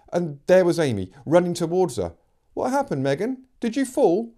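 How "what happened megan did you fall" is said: put-on worried girl's voice. Amy worried, running up.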